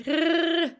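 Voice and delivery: R trilled with the tongue vibrating; rolled Russian R. Shown as a demonstration. Teacher to students, demonstrating the rolled R. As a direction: let the tongue shiver on R. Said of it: "let your tongue shiver a little".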